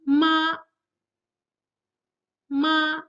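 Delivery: "Ma" held high and level; first tone. Shown as a demonstration. Teacher reads for students to repeat, "Ma" high and level. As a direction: sustain "Ma" on one high, steady pitch. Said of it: "after I read each tone twice".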